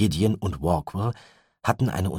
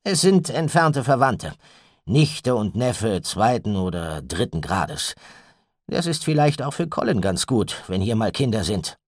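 raised, louder man's voice; Gideon's voice; 5 semitones higher, 5 dB louder than usual. A narrator's reading. Gideon tells Walkwell and the mother, firmly. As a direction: Gideon announcing his decision firmly.

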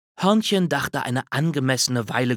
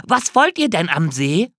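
higher, louder curious squirrel; eager squirrel question; 6 semitones higher, 5 dB louder than usual. A narrator's reading. Squirrel rushing down to ask the bear, eager. Hörnchen voiced in story.

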